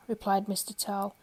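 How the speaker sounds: ordinary delivery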